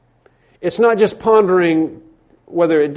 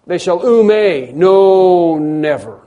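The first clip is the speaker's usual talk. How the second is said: "ume" and "no never" very slow and loud; emphatic no never; 3 semitones higher, 6 dB louder, much slower than usual. Shown as a demonstration. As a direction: drag out "no never" with full force. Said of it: "what it says here in this passage is".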